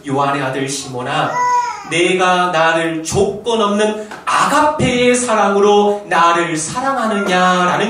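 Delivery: loud, slow, tearful preaching cry; pleading cry. Acted. Preacher crying out Jesus' question to Peter, tearful and loud. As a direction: cry out the Lord's question with feeling.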